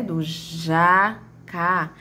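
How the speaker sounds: "jacá" split slowly into "ja" and "cá", with a gap; slow, separate beats on "jacá"; syllables separated. Shown as a demonstration. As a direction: say "jacá" one syllable at a time. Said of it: "As demais, como no caso do jacá".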